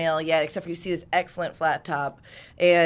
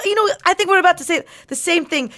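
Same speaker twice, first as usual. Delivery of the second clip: fast; 9 semitones higher, 7 dB louder, faster than usual. Acted. Fast overlapping aside.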